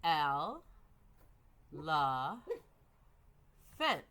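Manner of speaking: "El", "la", "fent" as isolated beats with long pauses; syllable-by-syllable. Shown as a demonstration. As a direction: break elephant into "El... la... fent". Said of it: "there's a pause that symbolizes a new syllable".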